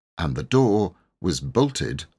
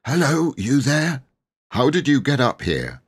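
a touch husky, higher and strained; husky reply; 7 semitones higher, 3 dB louder than usual. A narrator's reading. Trapped boy calling through the door, husky and high. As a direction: croak it out, barely able to speak. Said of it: "managed to reply huskily".

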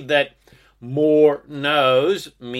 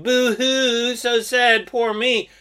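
high, mocking boo-hoo whine; mock self-pity; 8 semitones higher, slower than usual. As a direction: parody a sulking loser, pitched up. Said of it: "instead of going".

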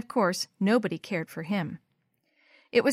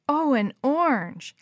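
slow, high, delighted exclamation; savouring it, pitched up; ecstatic sigh; 5 semitones higher, 4 dB louder, slower than usual. A narrator's reading. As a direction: draw it out with delight. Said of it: "Lisbeth's sigh was ecstatic".

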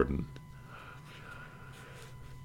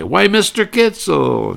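much higher, slow, drawn out; Jack's greeting; 5 dB louder, slower than usual. Retold or imitated. Jack greeting the vendor, much higher and slow. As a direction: greet him with mock delight. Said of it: "And Jack would say".

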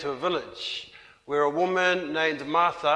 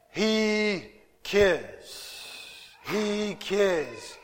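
tender reassurance; 4 dB quieter, much slower than usual. Real, not acted. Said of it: "repeated reassurance".

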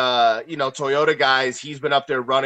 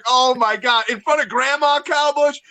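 mock scolding; 8 semitones higher than usual. Friend teasing Kyle Busch for wrecking in front of grandma, high mock scolding. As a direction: scold him for shaming grandma. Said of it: "I'm just like".

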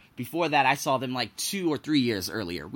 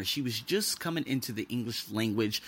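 usual speaking voice

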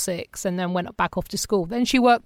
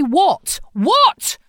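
a higher, louder second "What?" climbing in exasperation; frustrated husband; 7 semitones higher, 4 dB louder than usual. Retold or imitated. Husband's baffled reaction to his forgetful wife, rising exasperation. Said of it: "he'd get really frustrated with me".

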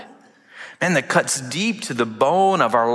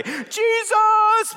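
very high ecstatic call; exalting cry. Acted. Churchgoers on Sunday cry out, very high ecstatic call. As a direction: cry out the name in worship.

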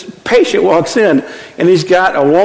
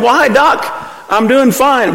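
put-on cheerful old patient; 6 semitones higher than usual. Patient voiced in told joke.

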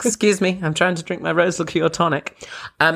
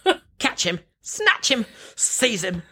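mock outraged chase; 10 semitones higher, slower than usual. Acting out the outraged reply in Oliver.

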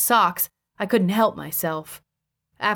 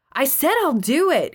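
higher, impatient dismissal; high-pitched, dismissive; 8 semitones higher, 3 dB louder than usual. Acted. Husband brushing off his wife, high and dismissive. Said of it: "in a high-pitched, dismissive tone".

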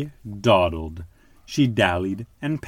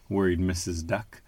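ordinary delivery, nothing marked

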